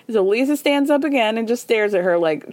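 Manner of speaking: ordinary talk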